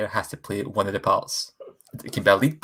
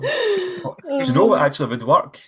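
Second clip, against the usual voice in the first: excited; 4 dB louder, slower than usual. Real, not acted. Sudden idea.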